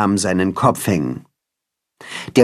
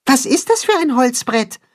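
much higher small mole voice; curious little burrower peeking out; squeaky voiced little mole; 13 semitones higher than usual. A narrator's reading. Max the mole asks.

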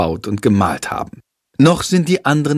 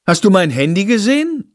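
voiced mother; 9 semitones higher than usual. Mother searching for her phone.

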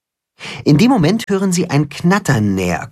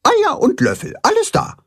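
very high-pitched hen voice; hen reporting. A narrator's reading. Blumenhuhn answers Max's checklist, high hen voice. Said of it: "antwortet das Blumenhuhn".

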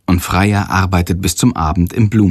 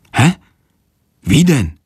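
puzzled, questioning reply; mildly astonished; 5 semitones higher, 3 dB louder than usual. A narrator's reading. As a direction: ask with a touch of bafflement. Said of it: "antwortet erstaunt". (leicht erstaunt nachfragen)

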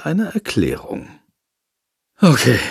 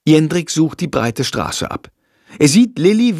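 ordinary delivery

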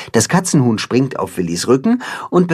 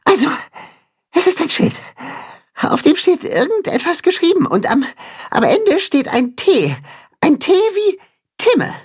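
high mole voice, puffing between phrases; out of breath. A narrator's reading. Mole reports back after crawling through the tunnel, out of breath. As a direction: gasp out the report in short bursts. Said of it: "berichtet außer Atem".

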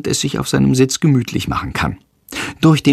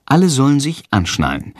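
ordinary delivery

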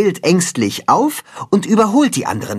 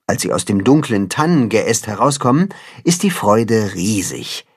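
ordinary delivery

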